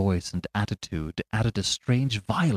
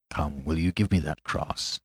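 same low voice; 6 semitones lower, 6 dB quieter than usual. Acted. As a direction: lower and softer quiet demand. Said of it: "in the same low voice".

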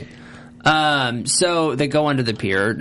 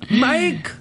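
mock startled call; 13 semitones higher than usual.

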